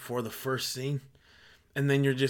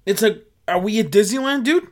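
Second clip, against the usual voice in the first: much louder, higher sarcastic jab; sarcastic outburst; 5 semitones higher, 10 dB louder than usual. Real, not acted. Mocking crowding strangers in line, loud sarcasm. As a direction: blast the question like it's absurd.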